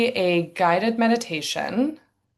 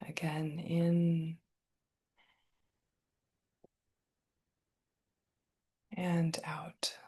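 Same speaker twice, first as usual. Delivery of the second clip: guided meditation; 5 dB quieter than usual. Acted. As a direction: guide the breath quietly, leaving long silences. Leads a meditation.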